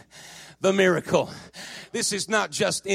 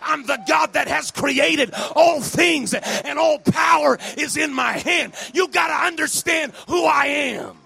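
forceful, declaiming preacher with punched key words; volle prediking; 3 semitones higher, 4 dB louder than usual. Acted. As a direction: proclaim it to the whole church.

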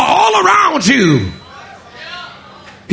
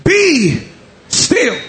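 high ringing shout on Peace, then settling; commanding rebuke; 7 semitones higher, much slower than usual. Acted. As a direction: rebuke the storm, stopping it cold. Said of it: "he rebuked the wind".

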